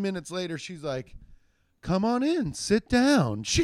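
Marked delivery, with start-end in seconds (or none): 1.8-3.4 put-on light, higher whimsical hostess voice, accent dropped; mock airy welcome, louder at the end. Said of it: "a whimsical voice".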